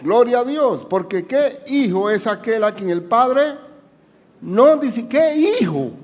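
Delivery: lee la Escritura más agudo y con fuerza; predicación enfática. Acted. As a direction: proclama el versículo como predicador. Lectura bíblica predicada. (predicador que proclama Hebreos a la congregación, más agudo)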